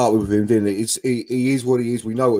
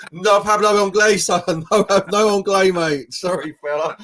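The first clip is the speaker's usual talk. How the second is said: high, loud, laughing mock reply; laughing mock Casemiro; 7 semitones higher, 4 dB louder than usual. Mimicking Casemiro waving off Maguire at a corner, laughing. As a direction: ham up the foreign player brushing him off, giggling. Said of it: "I'm sure he's doing the old".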